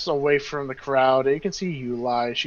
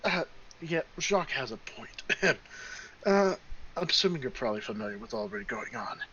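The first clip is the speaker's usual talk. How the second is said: lightly voiced, much softer halfling; halfling companion; 4 semitones lower, 9 dB quieter than usual. Halfling tells of their escape, soft and hesitant. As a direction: explain hesitantly in a quiet, low-key voice.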